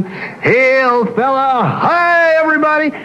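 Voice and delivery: high, hearty hail-fellow voice, voice jumping way up; loud backslapping greeter. Retold or imitated. Husband hailing everybody at a party, hearty backslapper voice. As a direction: imitate the hand-shaking extrovert greeting everyone. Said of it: "he was loud like that".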